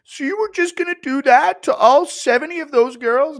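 high, loud whiny outrage; Schmitty impression. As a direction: squeal it like you're personally betrayed. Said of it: "Impression of Schmitty finding out".

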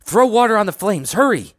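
high-pitched frantic orders; urgent yell. A narrator's reading. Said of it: "he yelled".

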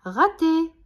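loud, high teasing call; gleeful jeer. A narrator's reading. Jean-Claude mocks Billy's missed throw, gleeful jeer. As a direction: crow with amusement at the miss. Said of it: "rigole Jean-Claude".

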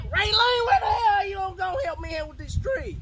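high, strained shout for help; yelling for help. Real, not acted. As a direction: holler at her to come help. Calling wife for help with tree.

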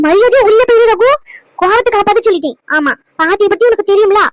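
put-on a loud, angry old woman telling him off; granny laying down the law; scolding grandmother. Grandmother to grandson over sending his pregnant wife away, loud scolding.

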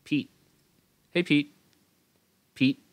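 "Pete" cut dead at an unreleased T; stopped final T. Shown as a demonstration. Teacher shows the stop T, no release. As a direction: hold back the T at the end of "Pete". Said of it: "Dừng luôn".

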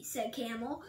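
ordinary delivery

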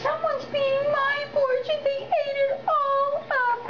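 tiny, very high-pitched wailing cub; wee small Baby Bear voice. A narrator's reading. Baby Bear finds the bowl empty, wee high wail. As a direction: squeal it high, stretching the final words. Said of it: "in his wee, small voice".

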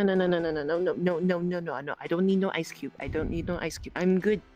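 ordinary talk

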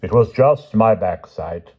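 slow, lightly voiced modesty; modest sigh. A narrator's reading. Merlin answers the king's praise, mildly modest. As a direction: shrug off praise slowly. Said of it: "sighed Merlin modestly".

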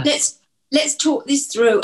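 slow, gentle and calming. Pretend. Mum role-plays comforting a panicking son, slow and gentle. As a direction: talk them down slowly. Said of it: "I would say".

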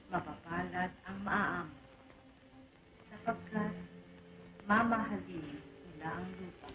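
low, slow, reverent reading of scripture; solemn recitation. Acted. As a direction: recite it like a prayer in church. Recites the Beatitudes.

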